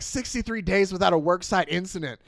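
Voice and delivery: building indignant yell; mock outraged complaint. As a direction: rant like an aggrieved worker, hammed up. Retold angry worksite crew complaint.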